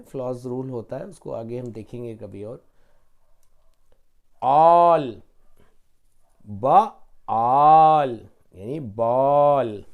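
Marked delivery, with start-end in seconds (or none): 4.4-9.8 all then ब, then आल drawn out slowly and loud, blending into बॉल; sounding out ball. Shown as a demonstration. Teacher to children, slow loud sounding out of बॉल. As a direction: stretch each sound slowly, then join them. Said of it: "L जो होता है हमेशा आखिरी में वो double हो जाता है".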